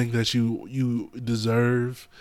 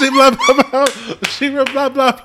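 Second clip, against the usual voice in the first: mocking chatter; 13 semitones higher, 9 dB louder than usual. Mocking a preachy woman.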